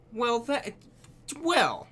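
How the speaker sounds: sputter, caught off guard; flustered. Acted. Flustered reaction after the gasp.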